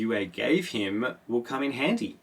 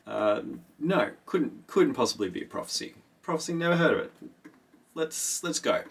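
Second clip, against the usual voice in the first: mock denial; 5 semitones higher than usual. Kynes denying the prophecy.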